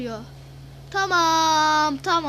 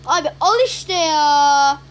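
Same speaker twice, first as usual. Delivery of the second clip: frustrated yell; 10 dB louder, slower than usual. Real, not acted. Frustrated at game loss.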